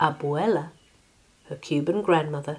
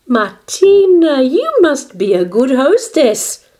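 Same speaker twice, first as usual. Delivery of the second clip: high, very loud reprimand; scolding; 9 semitones higher, 10 dB louder than usual. A narrator's reading. Grandmother reprimands Martina, scolding. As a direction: stretch the name, then scold at full voice. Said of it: "scolded her grandmother".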